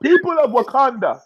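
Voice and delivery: rally the nation, laughing underneath; hammed-up proclamation. Host repeats the salute for co-host, mock-heroic call.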